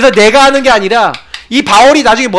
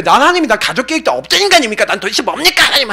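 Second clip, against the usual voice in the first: raised-pitch mock protest to God; retold angry prayer; 4 semitones higher than usual. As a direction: act out an exasperated complaint in prayer. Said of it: "기도하면서 너무 화가 나갖고".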